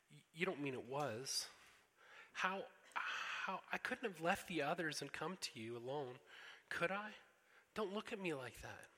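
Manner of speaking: put-on high, anxious girlish voice; a child caught out and squirming; upset girl Lucy. Lucy upset before Aslan.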